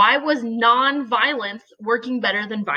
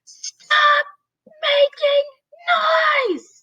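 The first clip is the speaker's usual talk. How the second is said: high-pitched drawn-out scream; shrill teacher's yell, far above normal pitch; much slower than usual. Acted. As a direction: scream it at a noisy class, stretching out the words. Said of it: "I start screaming".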